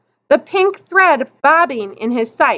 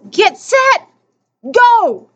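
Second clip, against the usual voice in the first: high, ringing race start; raised, punchy starting call; starter's shout; 8 semitones higher, 3 dB louder, slower than usual. A narrator's reading. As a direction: holler the runners off the line.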